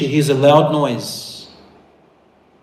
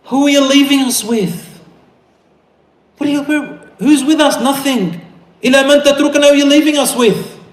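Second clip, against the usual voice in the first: high, raised, desperate questions; anguished pleading; 11 semitones higher, 4 dB louder than usual. Acted. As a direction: plead with a husband who will not turn. Abandoned wife pleading.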